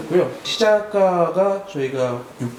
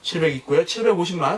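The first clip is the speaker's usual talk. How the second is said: lively raised auction calling; auctioneer call; 6 semitones higher than usual. Shown as a demonstration. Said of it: "시범을 한번 보여드려 볼게요".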